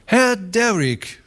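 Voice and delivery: high, fawning voice, quick in the middle; fat Beermann greeting. A narrator's reading. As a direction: Beermann sucks up to the ranchers, high and fast. Beermann voice again.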